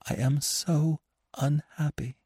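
high, soft, slow lament; unhappy woman. A narrator's reading. Anna to Gurov on the stairs, soft high lament. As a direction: ache through each word.

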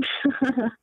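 brief awkward chuckle; nervous laugh. Real, not acted. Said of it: "are you aware of how much you laugh".

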